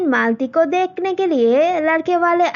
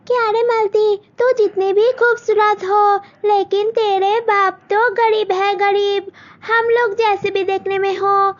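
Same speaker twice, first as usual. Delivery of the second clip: high, mocking teenage girl voice; jealous friends mocking Malti by the river; taunting village girls; 5 semitones higher than usual. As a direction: sneer at her like a spiteful friend.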